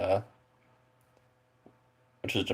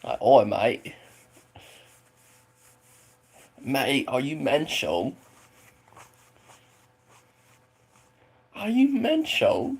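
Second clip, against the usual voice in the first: mock cockney, slow and hammed-up; British accent; 7 semitones higher, 4 dB louder, slower than usual. Streamer faking a British accent for chat, playful cockney. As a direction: put on a rough British accent to a chat viewer. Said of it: "Talking in British".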